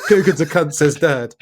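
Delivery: amused. Real, not acted. Laughs while repeating the punchline.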